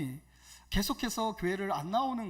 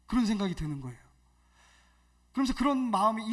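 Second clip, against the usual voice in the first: ordinary talk, nothing marked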